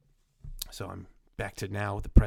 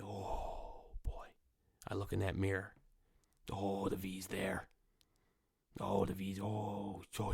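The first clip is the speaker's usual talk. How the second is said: whisper; 3 semitones lower, 8 dB quieter than usual. Retold or imitated. Morning dread at mirror.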